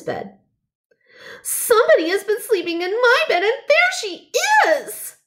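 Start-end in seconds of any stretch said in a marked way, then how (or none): 1.4-5.2 very high, fast little bear shout, rising; fast, high baby bear cry. A narrator's reading. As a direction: blurt it out high like a tiny bear who finds her. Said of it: "cried Baby Bear".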